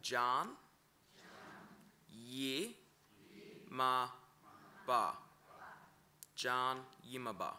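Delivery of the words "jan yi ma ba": "Jan, Yi, Ma, Ba" split into separate syllables with pauses. Shown as a demonstration. Teacher breaking down "Jan, Yi, Ma, Ba" for learners. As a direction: sound out "Jan, Yi, Ma, Ba" one syllable at a time.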